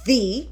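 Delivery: "the" said as THEE to make a point; emphatic "thee". Shown as a demonstration. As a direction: pronounce "the" with a long ee. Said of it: "use the word".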